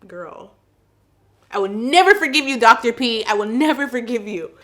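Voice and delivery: loud mock outrage. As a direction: voice the retainer, loudly betrayed. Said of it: "my retainer ... is like".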